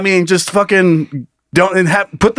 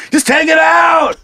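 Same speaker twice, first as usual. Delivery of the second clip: put-on drunk fan yell; 12 semitones higher, slower than usual. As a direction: yell it from the stands.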